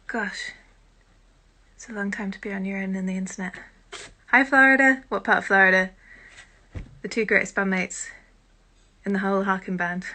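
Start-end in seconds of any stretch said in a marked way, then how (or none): 4.3-5.0 bright, loud, higher hello; excited greeting. Real, not acted. A streamer greeting Florida viewers, calling out delighted.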